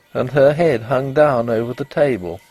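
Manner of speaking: plain reading voice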